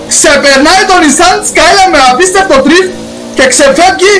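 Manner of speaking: lively play-by-play of his own drift; hammed-up motorsport commentary; mock race commentator. Commentator voice on overtake.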